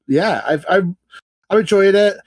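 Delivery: normal talking voice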